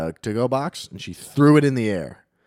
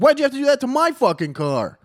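very high, loud complaint; retold high-pitched yell; mock outraged wail; 14 semitones higher, 5 dB louder than usual. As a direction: imitate a driver aghast at damage to their car. Acts out driver after smashed window.

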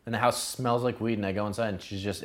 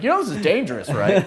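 mock fatherly lecture, pitched higher and louder; stern father; 9 semitones higher, 9 dB louder than usual. Warning the girl like her father, mock stern. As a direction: play her disapproving dad, half joking. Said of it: "I became, like, her father".

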